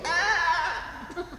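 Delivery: mock child's wail. Child who lacks words for pain.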